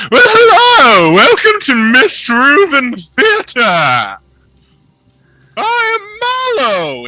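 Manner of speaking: very high, loud, theatrical showman voice; flamboyant halfling. Acted. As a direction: gush like a delighted stage impresario.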